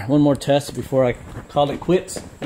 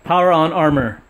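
voice command; 4 semitones higher, 5 dB louder than usual. Real, not acted. Commanding the suit's voice system.

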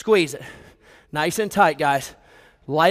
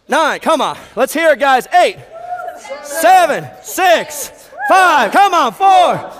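shouted countdown, much higher and louder; shouting; 9 semitones higher, 6 dB louder, much slower than usual. Real, not acted. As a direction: yell the count to push the class.

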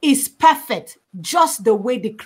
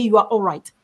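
normal talking voice

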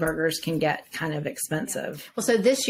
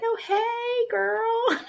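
much higher-pitched imitation of the booth owner's hello; imitating her greeting; much slower than usual. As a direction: pitch it way up for a mimicked "hey, girl". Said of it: "she's like".

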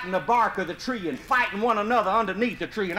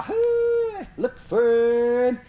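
high drawn-out hunting holler; hollering to the dogs; 6 semitones higher, 3 dB louder, much slower than usual. Retold or imitated. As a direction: sing out long to the hounds. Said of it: "my brother Sonny holler".

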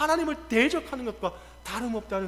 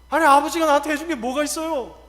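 loud, higher-pitched protest; child reproaching parent; 4 semitones higher, 7 dB louder than usual. Acted. Child talking back to father, loud reproach. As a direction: voice a grown child's indignant complaint. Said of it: "자식이 부모에게 이야기하죠".

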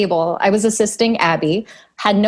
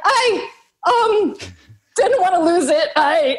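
flustered; 10 semitones higher than usual. Retold or imitated. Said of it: "I'm like".

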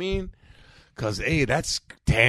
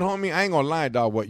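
ordinary delivery

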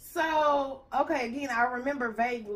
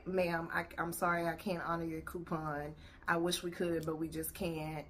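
soft, lower and polite; polite clerk voice; 5 semitones lower, 8 dB quieter than usual. Retold or imitated. Vlogger acting the clerk's polite refusal, soft and low. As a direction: say it gently, like a sorry clerk. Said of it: "That's what was said".

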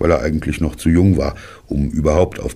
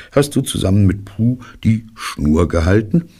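ordinary delivery

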